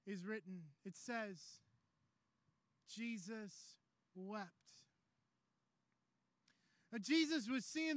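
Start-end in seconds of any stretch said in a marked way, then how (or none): 0.1-4.7 low, soft and slow, pausing between the words; hushed, solemn scripture. Real, not acted. Preacher reading the shortest verse to the congregation, hushed and solemn. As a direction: let the verse drop to a grave hush.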